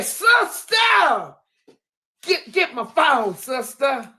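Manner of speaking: high excited yelling; excited shout. Retold or imitated. Sister yelling for the phone, high and excited. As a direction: shout it like she can't believe it. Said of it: "these were Nitra's".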